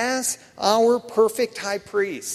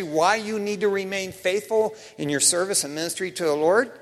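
ordinary talk, nothing marked

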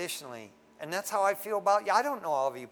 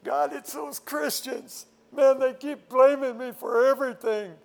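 whiny devil; 7 semitones higher, 6 dB louder than usual. Retold or imitated. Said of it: "the devil goes".

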